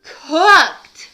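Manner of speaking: high, loud, near tears; scared news. Acted. Cassie telling Ollie the turkey gets cooked, fearful. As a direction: break the awful news.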